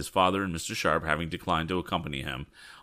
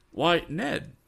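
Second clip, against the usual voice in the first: high, surprised hail to a friend; calling out as Tom; 11 semitones higher than usual. Acted. Tom spots Ned on the platform, calls out high. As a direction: call out to Ned in a lifted youthful voice. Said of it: "called Tom".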